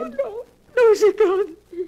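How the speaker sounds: tearful, loud protest; crying denial. Acted. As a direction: cry out against the news. Sister refuses to believe cat died.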